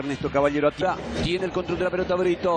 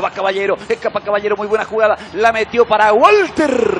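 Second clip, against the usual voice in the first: building excitement; 3 semitones higher, 7 dB louder than usual. Real, not acted. Attack builds in commentary.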